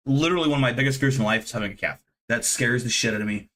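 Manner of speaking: ordinary talk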